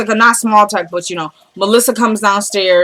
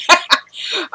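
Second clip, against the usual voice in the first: short laugh; 9 semitones higher, 3 dB quieter than usual. Real, not acted. Laughs at own joke.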